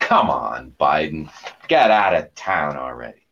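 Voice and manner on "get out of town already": usual speaking voice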